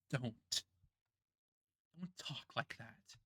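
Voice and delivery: soft comfort. Acted. Friend comforting despairing Karma.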